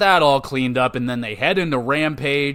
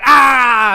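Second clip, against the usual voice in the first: loud, high crowd-style yell; a real roar from the stands, relived; excited shout; 9 semitones higher, 10 dB louder than usual. Retold or imitated. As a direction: let out a big fan's roar. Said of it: "I'm just like".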